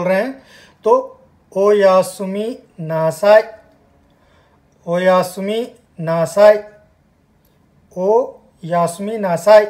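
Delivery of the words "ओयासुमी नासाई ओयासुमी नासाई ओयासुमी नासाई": ओयासुमी नासाई drawn out slowly, each syllable apart; slow careful teacher repetition of ओयासुमी नासाई; slow model phrase. Shown as a demonstration. Teacher to learners, modelling good night in Japanese: slow. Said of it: "देखो ध्यान रखना उच्चारण का".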